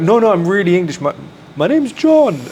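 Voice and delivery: high-pitched hammed-up English accent, insisting; put-on English accent. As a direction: overplay a posh English accent to prove the point. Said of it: "she hears the accent".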